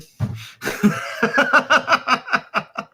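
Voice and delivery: high, wheezy burst of laughter; real helpless laughing; big amused laugh. Real, not acted. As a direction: crack up at a silly word choice. Amused by the word yammering.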